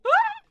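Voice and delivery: very high loud shriek; sudden high scream; startled scream. Real, not acted. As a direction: yelp in alarm. Sudden alarm at game.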